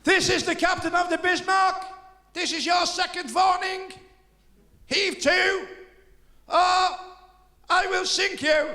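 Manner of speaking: high, loud, very slow booming command; megaphone. Acted. Bismarck captain ordering a ship to pull over, through a megaphone.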